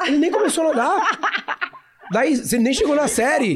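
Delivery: high, laughing through the words; can't stop laughing while telling it. Real, not acted. Host mocking amateur swimmers, laughing as he talks.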